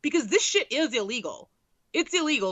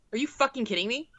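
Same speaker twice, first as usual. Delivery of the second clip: ordinary delivery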